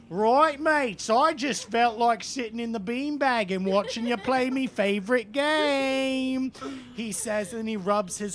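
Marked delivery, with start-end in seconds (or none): 0.1-6.5 hammed-up loud, higher-pitched British rocker; broad mock British accent, raised pitch, last word drawn out. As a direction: play a loud cartoon British musician.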